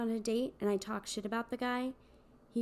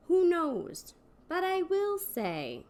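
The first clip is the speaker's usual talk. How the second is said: coy tease; 8 semitones higher, slower than usual. Acted. Coy confession.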